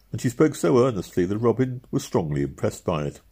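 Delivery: ordinary delivery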